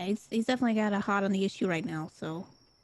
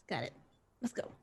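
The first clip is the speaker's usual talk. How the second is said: ordinary talk, nothing marked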